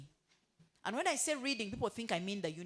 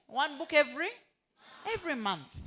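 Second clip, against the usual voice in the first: louder, higher, punching the words out; said boldly; 6 semitones higher, 6 dB louder than usual. Shown as a demonstration. Trainer urging staff to read monthly, said boldly and loud. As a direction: declare it boldly to the room. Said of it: "say it boldly".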